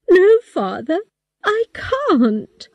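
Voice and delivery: pleading, voice high and cracking into tears; crying. A narrator's reading. Girl begging her father not to marry her off, crying. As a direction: beg through tears, pitch high. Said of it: "I started crying".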